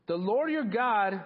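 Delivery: The Lord your God hammered out slowly; emphatic slow proclamation. Real, not acted. Preacher hammering the phrase.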